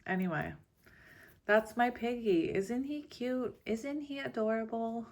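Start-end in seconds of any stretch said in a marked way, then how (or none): 1.4-5.1 cooing. Real, not acted. Cooing over stuffed pig.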